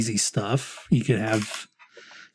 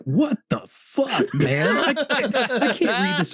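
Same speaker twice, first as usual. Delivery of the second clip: mock exasperation; 10 semitones higher, slower than usual. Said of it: "I'm like".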